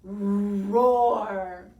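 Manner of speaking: loud, rolling little-dinosaur roar. A narrator's reading. Tiny showing off a terrific roar, loud roar. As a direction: roar it out long. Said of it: "with my terrific roar".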